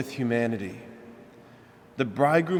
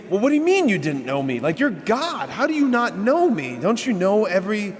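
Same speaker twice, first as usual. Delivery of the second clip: indignant disbelief; 5 semitones higher than usual. Retold or imitated. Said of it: "we would think".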